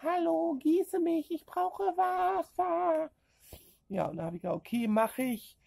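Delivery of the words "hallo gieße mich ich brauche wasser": high, slow little plant voice; voice of her plants. Pretend. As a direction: play a tiny thirsty plant begging for water. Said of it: "die sprechen mit mir".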